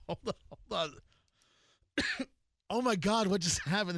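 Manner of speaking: choking, struggling to talk; coughing through the words; coughing on dust. Real, not acted. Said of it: "I just swallowed some dust".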